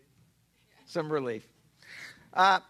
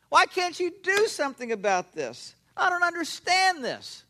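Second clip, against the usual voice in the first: pleading with God; 9 semitones higher, 4 dB louder than usual. Retold or imitated. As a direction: plead with God as if at the end of your rope. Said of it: "I pleaded".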